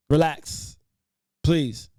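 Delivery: host loudly asking the panel to relax; firm call to relax. Real, not acted. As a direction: raise your voice and press them to calm. Host calming a heated argument.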